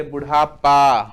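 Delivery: बुढ़ापा with पा stretched loud; slow down and boom the final पा of बुढ़ापा; long, loud "paa" closing बुढ़ापा; stressed ending पा. Shown as a demonstration. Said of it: "जिन शब्दों के अंत में आ".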